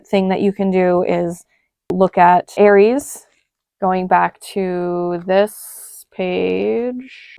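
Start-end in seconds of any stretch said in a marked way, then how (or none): 3.8-7.4 very slow, words dragged out absent-mindedly; slowed and distracted while looking down at the page; distracted slow speech. Real, not acted. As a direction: lose your train of thought mid-phrase. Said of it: "Girl, what?".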